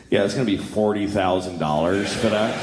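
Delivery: low, deadpan pharmacist voice; bored clerk delivering bad news. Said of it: "they give it to the pharmacist".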